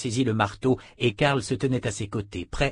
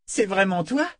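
delighted; 8 semitones higher than usual. A narrator's reading. Fitch delighted.

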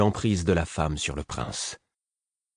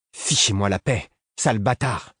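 hoarse exclaiming; 3 semitones higher than usual. A narrator's reading. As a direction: spit the insult hoarsely. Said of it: "s'exclama-t-il d'une voix rauque".

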